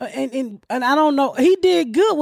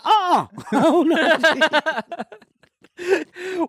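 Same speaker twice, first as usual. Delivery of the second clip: laughing denial; 5 semitones higher, much slower than usual. Retold or imitated. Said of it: "I said".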